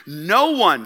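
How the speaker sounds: ordinary talk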